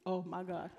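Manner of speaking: ordinary delivery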